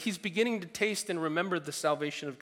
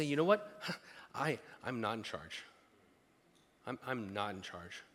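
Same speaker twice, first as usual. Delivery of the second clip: humble surrender; 6 semitones lower, 8 dB quieter than usual. Retold or imitated.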